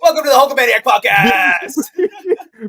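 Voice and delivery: high, slow, laughing announcer; hammed-up laughing host; laughing mock intro. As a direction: welcome them through laughter.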